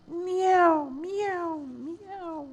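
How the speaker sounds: high meows falling away to nothing; fading kitten meows. Acted. Kittens sighing meow.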